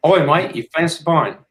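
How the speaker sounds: high put-on British pub voice; mock English accent. Inviting a mate for a pint, high mock English accent. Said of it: "The best I got".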